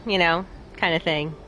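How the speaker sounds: normal talking voice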